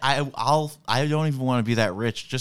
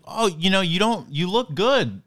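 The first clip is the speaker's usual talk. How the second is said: reassuring friend; 10 semitones higher, 5 dB louder than usual. Retold or imitated. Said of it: "I was like".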